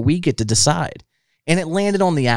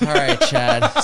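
laughing through the words, much higher; slower than usual. Real, not acted. Host laughing at his guest before the challenge. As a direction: crack up while saying his name.

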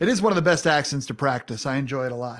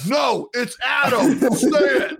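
slow, higher, half-laughing shout; mock insistence; 7 semitones higher, 4 dB louder, slower than usual. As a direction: demand they say your real name. Host imagines guest insisting.

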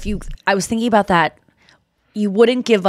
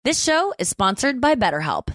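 ad read; 4 semitones higher, 5 dB quieter than usual. Acted. Host starts sponsor read.

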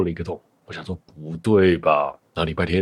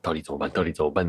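softer, dejected 到底怎么办; imitated despair; 3 semitones lower, 8 dB quieter, much faster than usual. Imagining fearing you have cancer, mild dejected murmur. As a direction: sink into hopeless worry. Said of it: "你会觉得".

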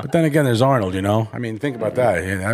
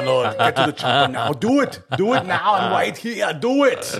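higher, punchy Austrian action-hero voice; Schwarzenegger impression; 5 semitones higher than usual. Mocking Arnold's accent for a friend, Austrian action-hero voice. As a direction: bark the lines like Arnold in a movie. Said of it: "Arnold can't do it either".

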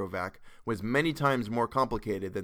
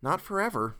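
higher-pitched, gentler retake; light, raised-pitch dialogue voice; Jared's character voice; 8 semitones higher than usual. A narrator's reading. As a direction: lift it into the father character's voice. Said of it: "Nope, not his voice".

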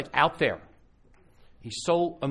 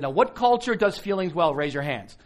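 much louder and higher, challenging the crowd; calling out to the room; 7 semitones higher, 10 dB louder than usual. Retold or imitated. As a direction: throw the question out to everyone.

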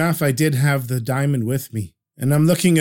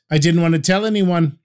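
plain reading voice

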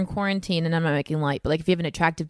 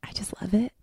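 breathy sultry whisper, very slow; sexy voice; 10 dB quieter, much slower than usual. Pretend. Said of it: "Can you say that in a sexy voice?".

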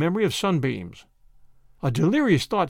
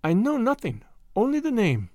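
put-on lightly accented and higher girl; French maid; 6 semitones higher than usual. A narrator's reading. The maid tells Tommy her place, light French accent.

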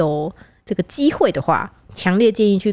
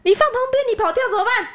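much higher imitated uncle, suspicious; fierce old uncle. The uncle refuses to move the car, much higher mock suspicion. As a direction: accuse her of planning to run. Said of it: "他说".

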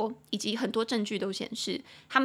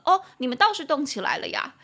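higher, louder mock surprise; pointed jab; 5 semitones higher, 7 dB louder than usual. Teacher voicing a commenter calling out Russians, pointed and louder. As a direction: throw it out as a sarcastic jab. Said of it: "讲得更呛".

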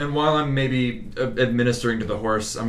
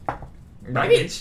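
whispered then a high goblin screech. Acted. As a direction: hiss it, then shriek like a goblin. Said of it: "how a goblin says good morning".

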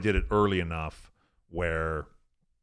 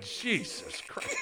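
slow, high, drawn-out disbelief; exasperated; 12 semitones higher, 5 dB quieter, much slower than usual. Retold or imitated. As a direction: stretch it out in half-laughing dismay. Driver watching girl pee.

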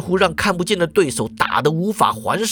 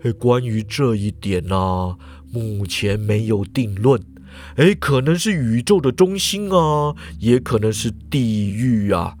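explaining professor; 7 semitones lower than usual. A narrator's reading. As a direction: lecture it low and unhurried. Professor gazing far.

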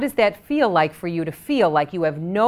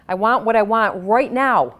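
higher and louder, insistent; mock impatient demand, louder and higher; 4 semitones higher, 6 dB louder than usual. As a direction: demand it like a child wanting it now. Tapping script voicing frustration.

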